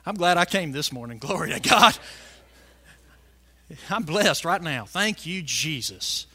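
low, soft aside of gratitude; quiet thanksgiving. Real, not acted. Preacher thanking Jesus between scripture readings, quietly grateful. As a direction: drop down low and give thanks.

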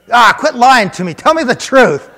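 high, loud, incredulous accusation; disbelieving shout. Retold or imitated. Man refusing to believe the healed man, disbelieving shout.

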